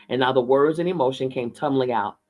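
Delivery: ordinary delivery, nothing marked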